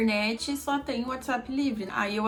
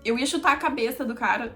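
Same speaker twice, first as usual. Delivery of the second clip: normal talking voice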